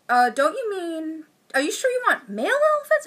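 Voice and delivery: high, fast, unsure mock voice; high mock wife voice. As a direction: squeak out a doubtful question. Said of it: "she's like".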